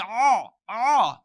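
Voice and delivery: loud "Oh, oh." pushed; the wrong pushed sound; wrong heavy oh. Shown as a demonstration. Teacher shows the wrong way, loud. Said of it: "you'll never say".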